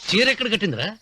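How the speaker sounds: indignant interruption. Acted. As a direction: cut him off sharply in protest. Master cuts off servant's daydream, objecting. (గట్టిగా అడ్డుకుంటూ)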